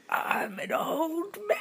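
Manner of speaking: a hint of a laughing old man; wobbly old man, laughing through it. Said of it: "Can you do an old man voice?".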